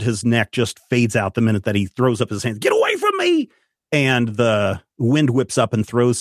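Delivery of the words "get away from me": high, frantic cry to back off; panicked shout. Acted. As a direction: scream it at the monster. Clyde repels the creature.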